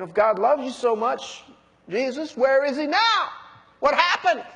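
high taunting questions ending in a laugh; mocking. Elders taunting the crucified Jesus, mocking. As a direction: rub it in with scornful questions. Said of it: "the elders mocked".

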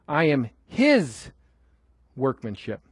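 slow, drawn-out "his", pause, then low "workmanship"; stress on his. Shown as a demonstration. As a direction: land hard on "his" and pause before "workmanship". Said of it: "I think it should be his".

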